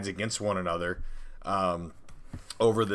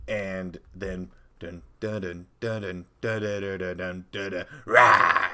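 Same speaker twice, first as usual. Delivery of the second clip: drumroll build; 3 dB louder than usual. Pretend. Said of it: "dramatic drumroll build".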